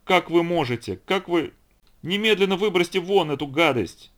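high-pitched indignant demand; acted fury, rising to a cry; furious outburst. A narrator's reading. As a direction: scold in outrage, pitched right up. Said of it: "уже ничего не соображая от ярости".

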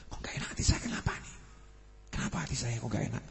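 hushed, worried whisper to oneself; muttering anxiously under the breath; whispered inner worry. Retold or imitated. Inner voice of restless heart. (bisikan hati yang gelisah)

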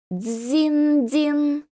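high ringing bell sound; bell chime imitation. A narrator's reading. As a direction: ring it out like a temple bell. Temple bell ringing in scene.

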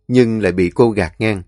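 ordinary delivery, nothing marked

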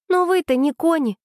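put-on high, cross girl's voice; high-pitched indignation, mildly acted; angry. A narrator's reading. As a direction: protest crossly in a high young voice. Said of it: "разозлилась Аня".

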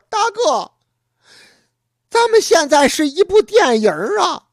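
excited exclaim. Acted. Younger brother exclaims excitedly.

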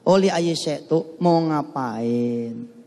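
slow, patient explanation; slow explaining. Shown as a demonstration. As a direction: explain it slowly like a teacher. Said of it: "Li ayyi syai' itu apaan?". (menjelaskan pelan dan sabar)

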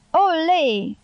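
a mild spectator's shout of Ole; called out. A narrator's reading. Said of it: "strigă cineva".

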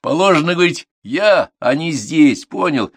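high, loud, drawn-out lecturing; exasperated drill instructor; stern correction. A narrator's reading. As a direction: spell out the rule to a dim pupil. Instructor corrects the pupil sharply.

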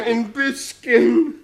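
desperate plea. Acted. Franz begs Death.

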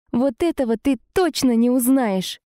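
high angry hissed retort; furious hiss. Acted. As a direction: spit it out in fury, high. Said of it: "разъярённо шикнула я".

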